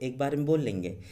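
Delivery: ordinary talk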